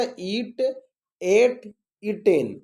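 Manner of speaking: eat, ate, eaten spaced out very slowly; memorisation drill. Shown as a demonstration. Teacher models how to memorise forms, slow chanted drill. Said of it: "इस तरह याद कीजिएगा".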